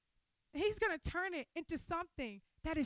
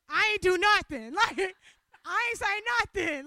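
loud, higher, laughing through the words; startled, laughing outburst; laughing protest; 5 semitones higher, 10 dB louder than usual. Real, not acted. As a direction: laugh it out in disbelief. Laughing protest at the crying cashier.

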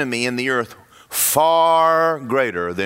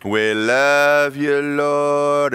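slow, showy church praise; a slow, drawn-out mock worship line; put-on hallelujah; much slower than usual. Churchgoer putting on hallelujah for God, slow showy praise. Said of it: "we all put on our hallelujah".

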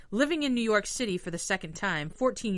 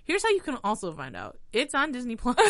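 trailer voice; 5 semitones higher, 6 dB louder than usual. Acted. Reads synopsis like ad.

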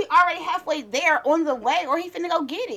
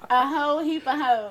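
normal talking voice

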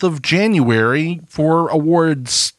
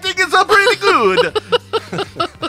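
high, slow Italian drawl; Italian accent; slower than usual. Pretend. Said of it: "particularly Italian".

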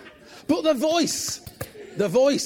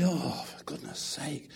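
whispered exasperated aside; soft unvoiced sigh of annoyance; 5 semitones lower, 7 dB quieter, slower than usual. Real, not acted. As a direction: mutter it under your breath, exasperated.

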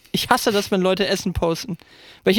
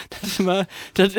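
usual speaking voice

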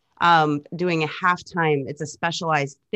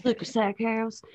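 playful put-on Southern accent, higher pitched; imitating their Southern tour banter; 5 semitones higher, 4 dB quieter than usual. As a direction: slip into a Southern twang. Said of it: "talking to each other in a Southern accent".

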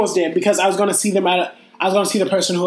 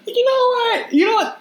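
much higher, fired-up rant opener; mock rant. Host about to rant at co-host over drinking, higher pitched. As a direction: launch into a playful rant.

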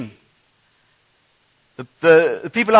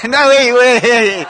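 laughing; 4 semitones higher, much slower than usual. Real, not acted. Laughing at the game.

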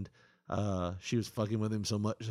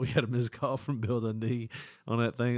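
usual speaking voice

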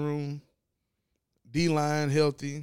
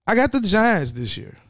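bold declaration; 6 semitones higher, 6 dB louder than usual. Real, not acted. Bold prediction shouted.

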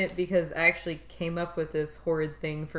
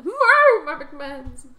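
put-on old lady voice; 7 semitones higher, 8 dB louder, slower than usual. Joins the old lady bit.

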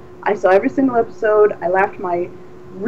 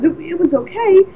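lightly imitated hesitant reply, higher; a hint of Shatner, halting; mildly mimicked pause before the word; Shatner deadpan; 8 semitones higher than usual. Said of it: "he pauses and he goes".

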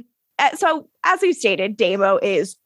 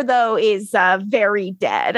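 normal talking voice

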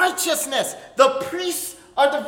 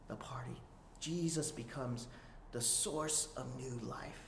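hushed reverent preaching; 8 semitones lower, 12 dB quieter than usual. Acted. Preacher awing the congregation, soft and reverent. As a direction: drop to a near-hush to let it land.

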